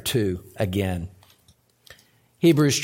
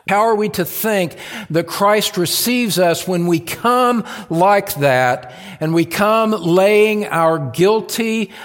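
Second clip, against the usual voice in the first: higher, louder preaching with stretched key words; impassioned preaching; 8 semitones higher, 6 dB louder than usual. Real, not acted. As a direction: preach it out to the whole congregation. Preaches at full force.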